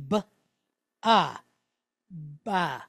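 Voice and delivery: "b", then "ah", then blended "ba"; sounding out syllable. Shown as a demonstration. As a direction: sound out "b" and "ah" separately, then join them into "ba". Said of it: "that's how we would be able to pronounce that".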